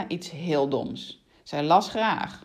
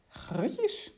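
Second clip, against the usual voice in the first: softer, high rising mild exclamation of effort; a touch of strain, pitched up; struggling to get up; 10 semitones higher, 4 dB quieter than usual. A narrator's reading. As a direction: say it while heaving yourself up. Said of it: "zei de ruiter moeizaam overeind komend".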